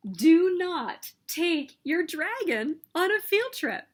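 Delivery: plain reading voice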